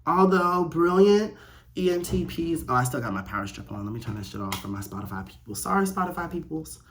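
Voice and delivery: ordinary talk, nothing marked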